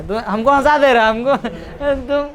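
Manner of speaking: ordinary talk, nothing marked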